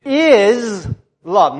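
"is" drawn out and set apart; stress on IS. Shown as a demonstration. Preacher pointing to the verse's key word, stressing "is". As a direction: lean hard on "is". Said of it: "look at that next word".

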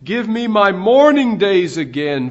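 fervent quoted cry, swelling on MOURNING; retold crying out. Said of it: "He cried out".

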